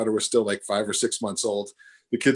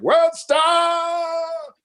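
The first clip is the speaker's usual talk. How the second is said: high, drawn-out gleeful holler; mock crowd shout, stretched out high; imitated bystander yell; 6 dB louder than usual. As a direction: yell it long and loud like a heckler. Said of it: "somebody to yell out something like".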